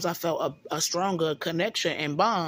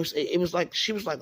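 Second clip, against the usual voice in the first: ordinary talk, nothing marked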